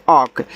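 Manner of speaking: ऑक said with an open "aw" and a silent L; demonstrated pronunciation. Shown as a demonstration. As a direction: model the word ऑक slowly and clearly. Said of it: "ऐसे ऑक".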